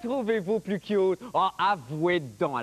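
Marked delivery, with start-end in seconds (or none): none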